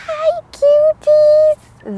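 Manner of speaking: very high, drawn-out loud squeal; cooing squeal. Real, not acted. Woman greeting cute rabbits, cooing squeal. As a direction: gush at adorable animals in a high, slow coo.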